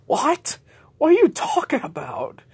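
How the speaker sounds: very high, loud baffled squeal; confused disbelief. Acted. People react to J J's claim, very high and baffled.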